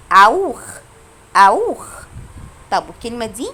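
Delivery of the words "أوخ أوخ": أوخ loud, ending in a throaty "kh"; CH as kha. Shown as a demonstration. Teacher shows how CH sounds here, throaty kh ending. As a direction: say أوخ as "owkh". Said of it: "تسي ها هنا يتنطقوا خا".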